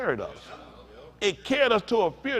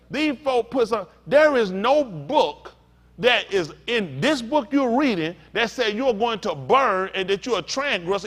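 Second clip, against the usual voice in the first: raised, emphatic preacher delivery; forceful preaching; 5 semitones higher, 3 dB louder than usual. Real, not acted. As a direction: drive each point home to the congregation.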